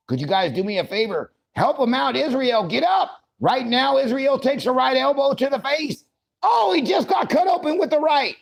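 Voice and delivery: rising commentator frenzy into a yell; excited commentary. Pretend. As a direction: get hyped calling the big elbow. Commentary builds to shout.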